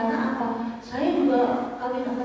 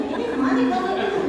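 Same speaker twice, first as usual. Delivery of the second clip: laughing question; 9 semitones higher, 3 dB louder, faster than usual. Real, not acted. Laughing at relative running off.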